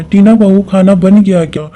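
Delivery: a mother-in-law repeating an unanswered question; calling out again. Acted. (जवाब न मिलने पर दोबारा पुकारती सास)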